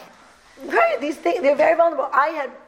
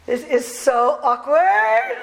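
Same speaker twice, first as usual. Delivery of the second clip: put-on drawn-out, slow teenage cringe; fifteen-year-old; 3 semitones higher, 4 dB louder, much slower than usual. Teen daughter to parents playing backgammon, exaggerated embarrassment. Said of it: "like a fifteen-year-old, she says".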